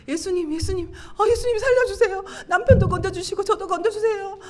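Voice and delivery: high, urgent acted cry for help; emotional pleading. Wife calling on Jesus to save her and husband, pleading. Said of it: "막 감정이 오르락내리락 막 하는데".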